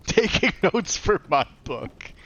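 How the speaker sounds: slow, high laughing joke. Real, not acted. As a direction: tease while still laughing. Joking after a laugh.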